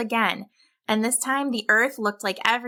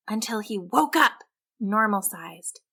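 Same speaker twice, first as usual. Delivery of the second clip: ordinary delivery, nothing marked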